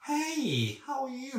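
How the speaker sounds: very high, gushy hello; exaggerated high-pitched pet-talk greeting; over-caring greeting. Shown as a demonstration. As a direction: greet them way up high, overdoing the care. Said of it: "But not that much".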